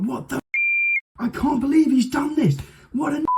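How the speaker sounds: high, mock outraged guest. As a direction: squeal it like a shocked guest. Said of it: "then they'd be like".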